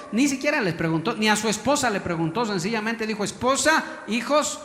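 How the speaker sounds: loud, rising preacher's emphasis; fervent preaching. Acted. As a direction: drive the point home.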